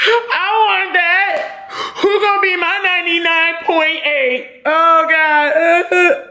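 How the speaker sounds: high, slow, half-crying laugh; laughing yearning. Real, not acted. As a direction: pine for it, laughing at yourself.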